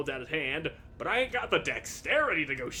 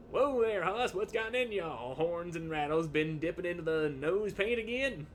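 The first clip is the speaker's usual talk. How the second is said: cowboy drawl; 3 semitones higher than usual. Acted. [name] greeting the dinosaur player in a cowboy twang. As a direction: hoot it out like a Western sidekick. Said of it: "[name] doesn't talk like that".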